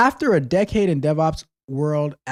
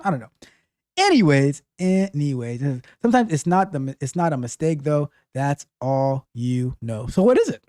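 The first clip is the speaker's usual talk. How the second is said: ordinary delivery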